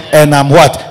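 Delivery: ordinary talk, nothing marked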